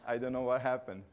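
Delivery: ordinary delivery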